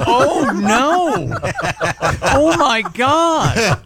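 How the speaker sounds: mock horror. Co-host reacting to a hot dog pun, mock horror. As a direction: stretch it out in hammed-up despair.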